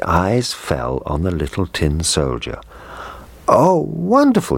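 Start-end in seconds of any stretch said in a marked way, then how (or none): 3.5-4.6 much higher, loud delighted cry; excited boy. A narrator's reading. Little boy seeing his presents, excited cry. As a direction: burst out with joy. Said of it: "he cried".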